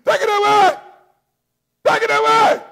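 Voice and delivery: high, loud desperate shout; begging cry. Acted. Paul begging God to remove the thing, loud begging cry. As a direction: beg at full voice. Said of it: "I begged".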